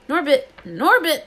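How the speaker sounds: high, loud cooing to a pet; doting call. A narrator's reading. Hagrid calls his baby dragon, doting.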